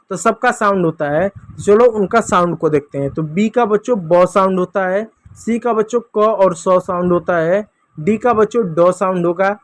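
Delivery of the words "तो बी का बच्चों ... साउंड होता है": ordinary talk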